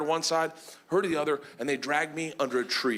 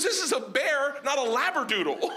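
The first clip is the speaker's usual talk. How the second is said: exasperated scolding; 8 semitones higher than usual. Retold or imitated. Exasperated trainer scolds him.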